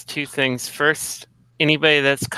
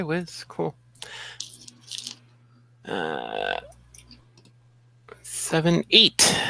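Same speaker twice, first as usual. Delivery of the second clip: usual speaking voice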